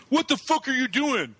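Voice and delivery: high, confrontational retold boss voice; angry boss. Imitating the boss confronting him over the thrown cake, angry. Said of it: "My boss starts getting in my face".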